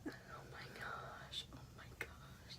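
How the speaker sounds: very soft, voiceless amazement; hushed, breath-only wonder; awed whisper. Real, not acted. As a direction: whisper it twice to yourself in awe. Awed by the ink.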